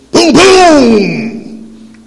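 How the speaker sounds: loud, high double boom; gunshot booms. Acted. Pete firing at ducks, loud booms. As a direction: shout the shots out big.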